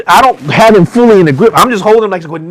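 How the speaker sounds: usual speaking voice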